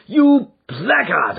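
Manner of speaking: slow, loud, high shout of rage; raging shout. Acted. Father beside himself with rage at his son. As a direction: spit the insult out in fury. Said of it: "I shouted, beside myself with rage".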